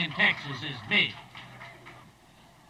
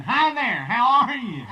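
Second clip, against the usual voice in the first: eager greeting; 6 semitones higher, 7 dB louder than usual. Acted.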